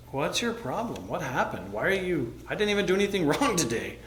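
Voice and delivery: high-pitched mock indignation, breaking into a laugh; half-laughing, bewildered self-defense; retold baffled protest. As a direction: plead innocence, comically bewildered. Retold baffled protest to angry supervisor.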